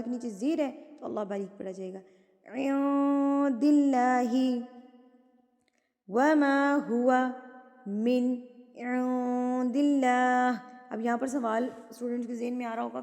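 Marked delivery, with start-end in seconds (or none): 2.5-10.6 slow drawn tajweed chant; slow measured recitation; Quran recitation. Acted. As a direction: recite with thin lam. Recites verse.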